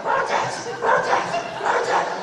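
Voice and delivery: high, loud call across a field; breathless high calling out. Retold or imitated. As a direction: yell his name from far off. Lady running across field calling.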